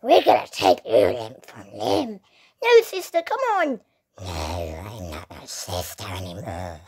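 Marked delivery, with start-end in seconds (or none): 0.0-2.2 low growling zombie threat. Pretend. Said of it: "What happened to the zombie voice?". 4.2-6.8 low, soft zombie voice; hushed undead growl. Pretend. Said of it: "What happened to the zombie voice?".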